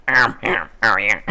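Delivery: throat closed up, coughing creature; Gollum. Shown as a demonstration. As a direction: choke the words out between hacks. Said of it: "I like close up my throat".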